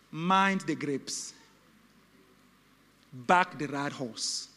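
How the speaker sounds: slow grave proclamation; solemn pronouncement. Acted. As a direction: lay down a divine message. Message God gave today.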